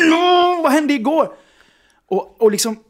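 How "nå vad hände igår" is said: high, loud thick-tongued wail; despairing outburst. Retold or imitated. Man begging his friend for answers, despairing wail. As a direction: wail it in comic desperation. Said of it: "jag är helt förtvivlad".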